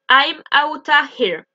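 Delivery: slow "outta here", "of" reduced to "a"; native-style contraction. Shown as a demonstration. Teacher repeating for learners, slow contracted "outta here". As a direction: draw out "out of" as one word, "outta". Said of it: "j'ai déjà deux contractions".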